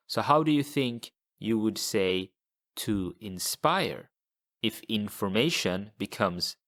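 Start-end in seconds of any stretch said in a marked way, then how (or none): none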